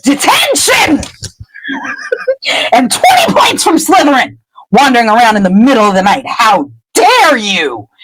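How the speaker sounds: high, sharp scolding professor voice, slowing on how dare you; indignant headmistress scolding; stern strict teacher. Retold or imitated. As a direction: tell off a pupil caught out at night. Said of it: "And she's like".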